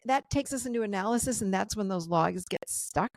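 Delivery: ordinary delivery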